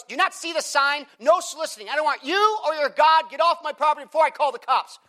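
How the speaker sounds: high, loud, furious rant at the door; a homeowner snapping at an evangelist to leave; angry homeowner. Retold or imitated. Homeowner ordering a preacher off her property, high and furious.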